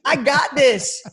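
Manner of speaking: high-pitched triumphant cheer; reliving giddy confidence; pumped-up self-talk. Retold or imitated. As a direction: psych yourself up before the big night. Reliving being on cloud nine.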